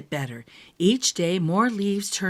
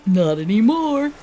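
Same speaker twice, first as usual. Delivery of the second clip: snuffled; 3 semitones higher, 7 dB louder than usual. Acted. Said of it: "snuffled the porcupine".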